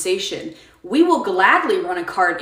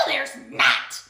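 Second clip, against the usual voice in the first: ordinary delivery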